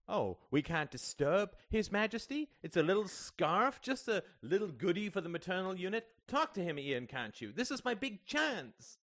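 high-pitched sarcastic complaint; mock, then plead with exasperated scorn; scornful. A narrator's reading. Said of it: "red with ill-suppressed scorn".

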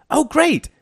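gushing, high-pitched enthusiasm; enthusiastic woman. A narrator's reading. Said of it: "said an enthusiastic female voice".

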